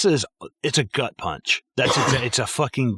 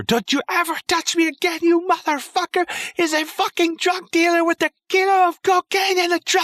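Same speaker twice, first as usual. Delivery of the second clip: mock screaming wife in a very high falsetto; ex-wife screaming to police during a traffic stop, high mock shriek; wife screaming impression; 4 dB louder than usual. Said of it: "this is where she gets him fucking pulled over".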